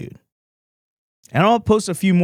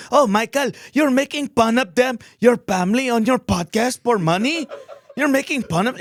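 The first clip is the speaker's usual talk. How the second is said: whiny critic; 11 semitones higher than usual. Retold or imitated. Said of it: "imagined critic".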